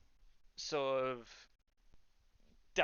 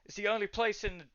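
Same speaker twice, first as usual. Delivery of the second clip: terrified guard; 3 semitones higher than usual. Acted. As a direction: plead urgently for reinforcements, pitch raised. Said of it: "terrified right now".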